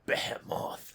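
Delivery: whispered, savoring the name; dramatic whisper. Shown as a demonstration. Player relishing her cooler name for the monster, whispered. Savouring own mispronunciation as cooler.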